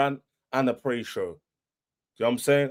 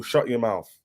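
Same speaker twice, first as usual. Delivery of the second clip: ordinary talk, nothing marked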